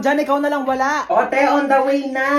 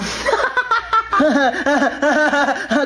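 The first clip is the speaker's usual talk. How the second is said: mocking laugh; 2 semitones higher than usual. Laughing at sibling.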